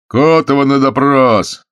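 loud, high, drawn-out call down a corridor; booming shout. A narrator's reading. Guard calls a prisoner for interrogation, booming shout. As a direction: holler it out slowly and resonantly. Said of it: "зычно прокричал кто-то".

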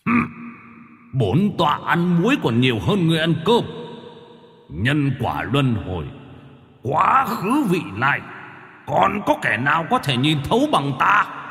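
high, boastful angry elder; angry spirit. Acted. Yellow-bearded spirit scolding Minh Hải, high angry boast. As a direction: glare and scoff down at him. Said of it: "giận dữ trợn mắt".

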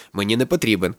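plain reading voice

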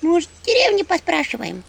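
high imp voice; imp suggestion. Acted. Imp Antipka suggesting they ask in the village. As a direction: offer it lightly as a little imp. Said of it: "предложил Антипка".

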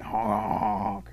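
high, loud honking; imitated goose honks. Storyteller voices geese flying in, high loud honks. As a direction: honk like incoming geese. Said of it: "the geese come flying".

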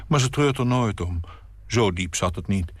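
ordinary delivery

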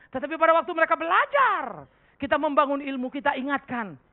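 high, loud pulpit voice; full-force sermon delivery; fiery preaching. Acted. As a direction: drive the point home to the congregation.

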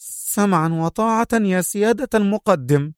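high, resentful obedience; obey in a high, indignant voice; angry, sharp. A narrator's reading. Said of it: "في غضب وهي تقول في حدة مماثلة".

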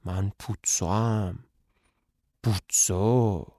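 low, slow, looming self-introduction; menacing voice. Acted. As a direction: drop the voice low and drag out the name like a threat. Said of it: "با صدای ترسناک".

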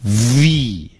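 long buzzing start on "V", then stretched ee; exaggerated teaching model of "V"; lip-teeth V. Shown as a demonstration. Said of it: "一定要上牙咬下唇".

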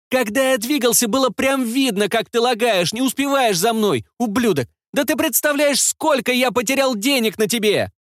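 put-on high boy's voice ranting; bratty teen whose voice hasn't broken; unbroken child voice. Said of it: "раз голос не поломался".

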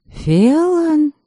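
high, questioning name; surprised question. A narrator's reading. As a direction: catch the slip in disbelief. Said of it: "переспросила я".

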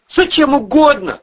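high, loud, eager begging; gabbled plea. A narrator's reading. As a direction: jump in desperately with the offer. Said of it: "затараторил Андрей".

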